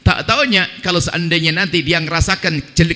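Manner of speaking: ordinary delivery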